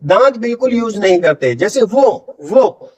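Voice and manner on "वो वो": "वो" with both lips rounded, teeth kept out; pure lip-rounded W in "वो"; lips-only W. Shown as a demonstration. Teacher demonstrating for learners, "वो" from the lips alone. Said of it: "वो सिर्फ lips use होंगे".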